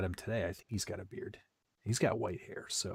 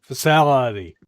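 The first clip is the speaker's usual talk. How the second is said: Cable Guy quote; 2 semitones higher, 8 dB louder than usual. Retold or imitated. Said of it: "What movie is that from? Cable Guy".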